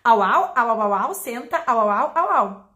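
loud playful barking au au with a senta in it; mock dog-language command; dog talk. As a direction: bark at the dog in mock doggish. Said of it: "vou traduzir em cachorrês".

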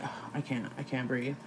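very soft, low mock exhaustion; exhausted, breathless. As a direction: let the words sink away as if out of air. Acting out being too tired to breathe.

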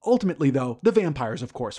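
normal talking voice

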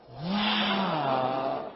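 long, drawn-out mock wow; imitated group amazement. Speaker imitating seven guests at the gum trick, mock-amazed. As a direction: stretch out a wowed group reaction. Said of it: "Y los siete dicen".

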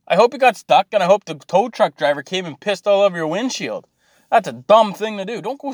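real anger spilling over; angry rant. Real, not acted. Ranting at skaters who got stuck, angry and loud.

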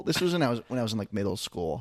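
normal talking voice

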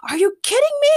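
high, loud outraged scream; shrill scream. A narrator's reading. Morgana screaming at the others in the car, outraged. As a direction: scream it in disbelief. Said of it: "Morgana screamed".

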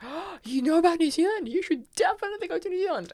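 gasp, then high-pitched eager urging; imitated excitement. Agent urging student toward New Zealand, imitated excitement.

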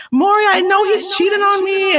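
high, whiny guest on a talk show; guest pleading with Maury. Retold or imitated. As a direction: pitch way up as a tearful accuser.

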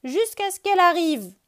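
high, loud sulky rabbit; grumbling. Acted. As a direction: grumble it like a stubborn child. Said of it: "bougonne Lapin".

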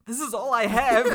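hammed-up heartbreak while cracking up; mock sadness. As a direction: imitate a wounded man, laughing. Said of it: "you could see the sadness".

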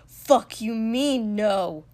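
loud, drawn-out challenge; heated, incredulous shout; angry retort. A narrator's reading. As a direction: throw it back furiously.

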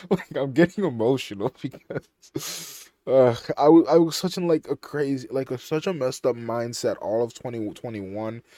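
choked up, laughing through tears; on the edge of tears, voice breaking loud; emotional. Real, not acted. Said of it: "I'm gonna start crying".

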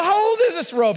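nervous laugh in a high alarmed voice; laughing panic. Retold or imitated. Asking his friend about the rope, high, laughing and nervous.

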